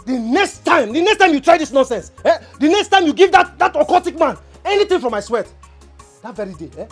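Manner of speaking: loud, high furious threat; angry shout. Acted. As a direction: yell the warning at him in full fury. Threatening Uncle Ferogi over occultic man.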